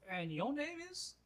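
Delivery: high, lightly voiced old man; old miner's voice. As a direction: ask politely in character. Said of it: "He just goes".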